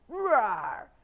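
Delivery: short, loud storybook roar; bear roar. A narrator's reading. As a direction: let out a practice roar. Bear practises barging in.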